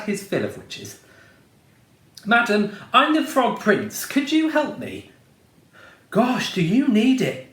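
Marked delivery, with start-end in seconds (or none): 2.3-5.1 polite pleading prince. A narrator's reading. 6.1-7.5 fairy godmother. A narrator's reading. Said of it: "said the fairy godmother".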